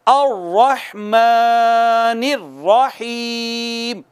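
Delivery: अर्रहमानिर्रहीम drawn out in two places; slow recitation. Shown as a demonstration. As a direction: recite slowly for the class to repeat. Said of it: "पढ़िएगा मेरे बाद". (मेरे बाद दोहराने के लिए धीमी तिलावत)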